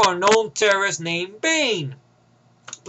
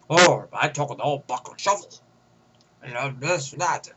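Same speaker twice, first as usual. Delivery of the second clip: Sean Connery as Bane; 8 semitones lower than usual. Retold or imitated. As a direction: lower mock Bane voice doing Connery. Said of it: "is basically Sean Connery's voice".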